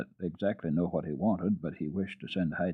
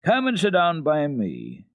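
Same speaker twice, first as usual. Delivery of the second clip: louder, welcoming father; hearty father; 6 semitones higher, 6 dB louder, much slower than usual. Acted. Father inviting the tutor, louder and welcoming. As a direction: warmly bid him sit.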